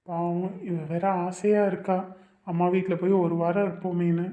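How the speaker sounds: soft, slow inner monologue; private musing. Acted. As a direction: mull it over quietly to yourself. Man's inner thought about wife. (மனசுக்குள்ள மெதுவா யோசிக்கிற குரல்)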